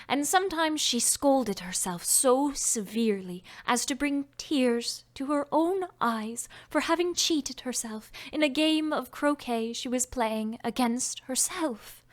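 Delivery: plain reading voice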